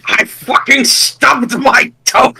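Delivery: slow, high pained complaint; stubbed toe. Retold or imitated. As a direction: whine it out like a hurt villain. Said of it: "looks like he stubbed his toe".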